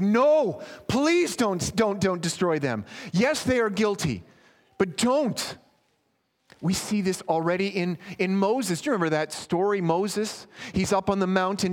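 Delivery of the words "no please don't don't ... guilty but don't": loud, high urgent plea; raised desperate intercession; pleading mediator. Acted. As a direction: beg God to hold back. Mediator begging God in quote.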